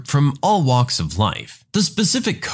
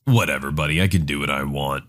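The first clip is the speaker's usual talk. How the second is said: put-on American accent; 10 semitones lower, 3 dB quieter, faster than usual. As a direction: brush him off in an American accent, low. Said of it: "American accent replies".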